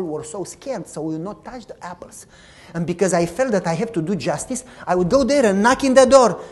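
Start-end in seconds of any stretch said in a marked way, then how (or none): none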